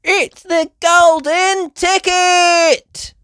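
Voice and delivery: high, loud, slow cry of joy; excited gasp. Acted. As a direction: gasp it out with wonder. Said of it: "gasped Peppa and Daddy Pig".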